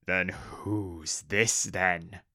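very slow, looming orc voice; suspicious orc boss. Acted. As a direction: draw it out as an accusing orc. Orc boss questioning the goblins.

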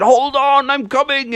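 high-pitched rescuer's call. Acted. Father bear rushing to save his daughter, high call.

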